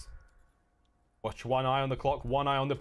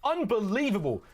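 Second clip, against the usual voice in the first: excited play-by-play; 12 semitones higher, 3 dB louder than usual. Real, not acted. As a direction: pitch climbing high, louder, hype call. Caster reacting to clutch kill.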